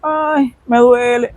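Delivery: a high little whiny ay; mock mild whimper. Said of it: "no esperes que alguien con dolor se te queje". (quejido leve y agudo de burla)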